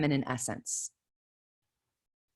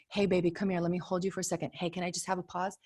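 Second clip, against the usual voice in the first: softer, gentle soothing; tender mother; 7 dB quieter than usual. Retold or imitated. Mother gently calming her four-year-old during a call, soft. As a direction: soothe a crying child quietly. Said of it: "If I look at my four-year-old and I say".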